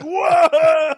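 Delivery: high, loud, drawn-out whoa; amazed whoa. Retold or imitated. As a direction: sound floored by dad. Reacting to dad's defiance.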